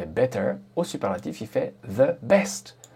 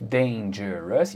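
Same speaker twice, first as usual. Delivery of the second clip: "dangerous" slowed, syllable by syllable; drawn-out syllables; 2 semitones lower, much slower than usual. Shown as a demonstration. Teacher showing the adjective is long, "dangerous" slowly drawn out. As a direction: stretch "dangerous" out, DAN-GE-ROUS.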